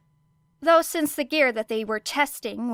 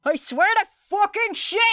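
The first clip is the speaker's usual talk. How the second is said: much higher, louder curse as she's launched; panicked yell; 11 semitones higher, 4 dB louder than usual. Acted. Launched without a harness.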